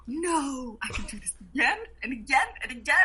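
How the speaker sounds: high and fast, bursting with eagerness; eager. Retold or imitated. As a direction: blurt it out thrilled. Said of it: "I was like".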